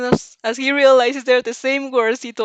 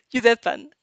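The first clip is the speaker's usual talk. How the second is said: plain reading voice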